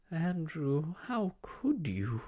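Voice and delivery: high, slow reproachful moan. Acted. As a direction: moan at the ungrateful dog. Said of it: "moaned Miss Lark".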